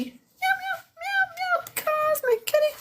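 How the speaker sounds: very high, soft kitten meows; cosmic kitty meows. Pretend. Showing viewers the cosmic kitty drawing, high kitten meows. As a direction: mew like a tiny cute cat. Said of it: "We got the cosmic kitty".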